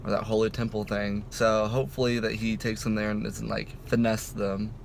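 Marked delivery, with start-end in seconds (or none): none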